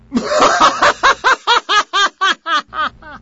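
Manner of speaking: long high burst of laughter; big laugh. Real, not acted. Audience laughing at the punchline. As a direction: crack up.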